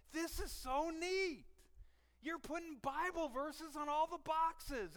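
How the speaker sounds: high, loud, drawn-out delight; gushing excitement. Retold or imitated. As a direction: re-enact gushing to coworkers, slowly stretched. Acting out his delight to coworkers.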